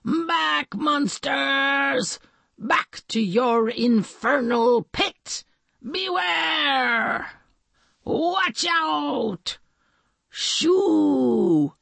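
high, drawn-out heroic cries; grand, high-pitched hero voice; superhero catchphrases. A narrator's reading. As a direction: call out like a would-be hero warning off monsters. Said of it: "tried out some catchphrases".

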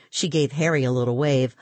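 ordinary delivery

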